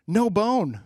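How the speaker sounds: shouted high and loud; mock shout. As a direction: bark it out like a cartoon. Acted cartoon quote in bit.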